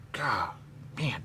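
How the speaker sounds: ordinary talk, nothing marked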